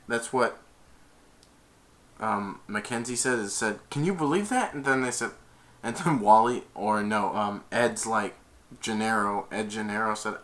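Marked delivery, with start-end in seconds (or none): 3.9-4.7 high, rising amazed question; imitated disbelief. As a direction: act out an incredulous onlooker. Same retold reaction.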